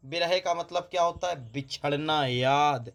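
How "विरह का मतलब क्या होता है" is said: ordinary talk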